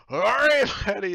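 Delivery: high, booming captain's shout; very loud, high-pitched cheer from a drunken captain; boisterous captain. Acted. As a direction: roar it out heartily. Captain takes the drink offered.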